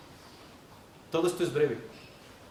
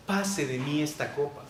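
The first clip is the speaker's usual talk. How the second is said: soft, lower pleading prayer; humble plea; 4 semitones lower, 7 dB quieter than usual. Shown as a demonstration. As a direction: beg humbly, gently. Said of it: "Es un ruego". (ruego humilde, suave y grave)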